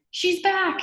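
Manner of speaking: high, loud panicked cry of fear; frightened shout. Acted. Lewis shouting in sudden fear at the playground. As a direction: blurt it out in terror. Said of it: "he shouted as he shook with fear".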